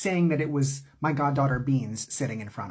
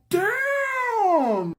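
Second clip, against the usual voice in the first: much higher, drawn-out hyped "Damn!"; mock crowd "damn"; 3 dB louder than usual. Friends reacting to the comeback, hyped high "Damn!". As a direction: cheer the burn like teenage boys.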